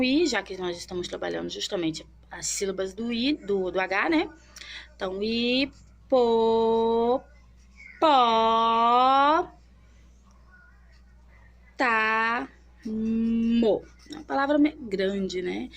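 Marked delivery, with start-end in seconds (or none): 4.9-13.8 hipopótamo split hi-po-pó-ta-mo, very slow; syllable by syllable. Shown as a demonstration. Teacher to children, spelling hipopótamo out slowly in syllables. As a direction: stretch hipo-, pó-, ta-, mo apart with pauses. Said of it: "Vamos ver quantas sílabas tem".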